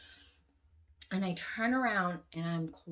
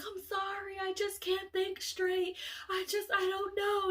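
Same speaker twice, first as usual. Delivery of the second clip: much higher, slow, flustered apology; upset friend; 13 semitones higher, slower than usual. Retold or imitated. The friend apologizing in panic over her daughter, much higher. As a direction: play her as rattled and lost.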